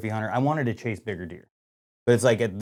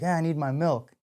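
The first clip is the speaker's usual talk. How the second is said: lightly voiced small child, pitched up; a hint of a kid waking dad; slightly higher child imitation; little son; 6 semitones higher, 3 dB quieter than usual. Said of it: "his son".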